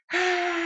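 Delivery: high sudden mock shout of the army; mock battle cry. Israelites shouting as Jericho's walls fall, high mock shout. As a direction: cry out like soldiers storming the walls. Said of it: "they start shouting".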